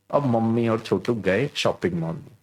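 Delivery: normal talking voice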